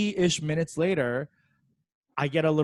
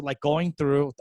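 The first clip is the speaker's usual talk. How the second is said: normal talking voice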